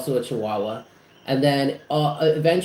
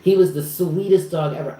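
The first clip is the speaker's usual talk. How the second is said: ordinary talk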